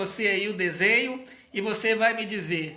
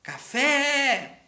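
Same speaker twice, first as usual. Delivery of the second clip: "Café" called out higher, with the last syllable "fé" hit hard; stressed last syllable; 6 semitones higher, 4 dB louder than usual. Shown as a demonstration. Teacher revealing the answer to pupils, calling it out higher. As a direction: announce "Café" brightly and punch "fé". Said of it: "A última sílaba é a mais forte".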